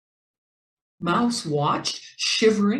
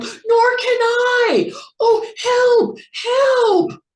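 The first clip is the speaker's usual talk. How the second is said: crying, much higher and louder, slow squeal; panicked squeal; 8 dB louder, much slower than usual. A narrator's reading. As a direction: wail for help as he flounders. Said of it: "And Jack Rabbit squealed".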